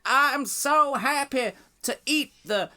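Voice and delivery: put-on Hillary Clinton's Southern accent. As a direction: lay on a hammed-up Southern US accent, far above your own pitch. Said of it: "she does the thing of".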